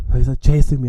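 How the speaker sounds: usual speaking voice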